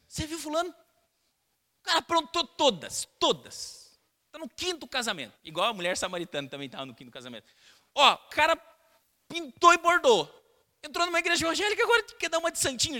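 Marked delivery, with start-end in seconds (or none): 0.1-5.4 high, mocking gossip voice. Church gossip mocking a man with a past, high and mocking. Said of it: "muitas pessoas dizendo assim". 8.0-13.0 high, loud mocking gossip. Gossip sneering at a converted sinner, high and loud. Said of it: "Já viu essa conversa".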